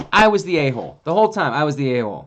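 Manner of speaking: ordinary delivery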